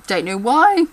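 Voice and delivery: ordinary talk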